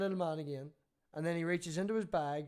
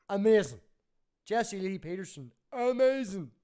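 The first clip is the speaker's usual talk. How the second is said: hyped-up yell; 3 semitones higher, 7 dB louder than usual. Retold or imitated. Bar customer hyping his trick.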